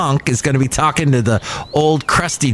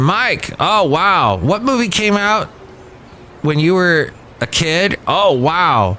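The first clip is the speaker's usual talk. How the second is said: lightly higher teasing voice; mocking younger colleague; 5 semitones higher than usual. Young coworker teasing Mike about his age, light mocking voice. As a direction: rib him about being old. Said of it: "went on and on about my age".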